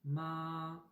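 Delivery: Ma held high and level like a musical la; first tone ma. Shown as a demonstration. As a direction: sing Ma on one high steady note. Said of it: "on dirait un peu le la de la note musicale". (Ma au premier ton, haut et plat)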